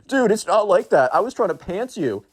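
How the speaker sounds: put-on high, whiny teen protest; mock a defensive teenager; whiny emo kid. Emo kid protests to Hank, high whiny teen voice.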